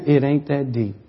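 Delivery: very soft and low; soft whisper. Retold or imitated. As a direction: let it fall away to a hush. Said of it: "the Lord whispers in my ear".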